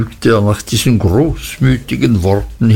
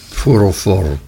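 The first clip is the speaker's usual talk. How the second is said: usual speaking voice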